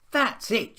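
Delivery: high, loud outburst; a girl's cross yell at the end of her patience; child shouting. A narrator's reading. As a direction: snap that she's fed up. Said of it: "she shouted".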